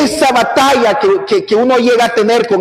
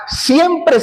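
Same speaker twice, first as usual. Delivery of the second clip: ordinary talk, nothing marked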